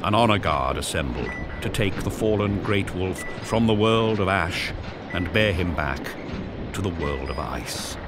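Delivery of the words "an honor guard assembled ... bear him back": usual speaking voice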